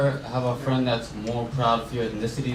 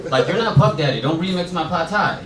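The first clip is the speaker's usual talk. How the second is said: louder, higher comic telling-off; mock scolding; 5 semitones higher, 5 dB louder than usual. Comic scolding a fusion cook, mock indignant. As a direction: tell off the cook in mock outrage.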